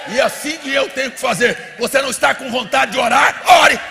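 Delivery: loud, high preaching, shouted commands; full-force exhortation. Real, not acted. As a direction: fire off each command to rouse the crowd.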